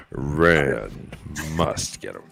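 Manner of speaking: deep booming "in a world" announcer; movie trailer voice. Pretend. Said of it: "That voice".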